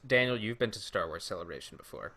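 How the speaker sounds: ordinary talk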